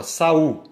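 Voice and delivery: "Saul" loud, as sa then ul; syllabes séparées. Shown as a demonstration. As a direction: split "Saul" between the a and the u. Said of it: "O A ficou separado do U".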